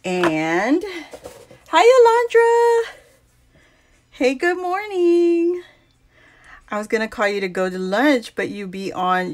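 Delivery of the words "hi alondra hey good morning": high, loud happy hello; excited greeting. Real, not acted. Greeting a friend who joined.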